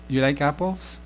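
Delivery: apples rising at the end; rising question on apples; question rise. Shown as a demonstration. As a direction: end apples with a clear upward question tune. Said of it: "the sound, the question mark".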